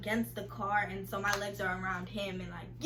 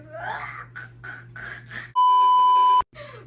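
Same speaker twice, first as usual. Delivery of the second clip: high shrieking laugh, loud; burst of laughter; 12 dB louder than usual. Real, not acted. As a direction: cracks up loudly mid-story. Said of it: "I'm sorry".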